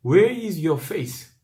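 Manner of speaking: very slow, every word drawn out in English; slow English reading. Shown as a demonstration. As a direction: read the English line slowly and gravely. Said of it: "영어 성경에는 진짜 그렇게 번역". (영어 성경 구절을 아주 천천히 읽기)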